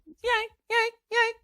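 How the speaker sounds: high sung screeches like stabbing strings; recalling the Psycho shower scene, shrill sung violin stabs; mock violin shrieks.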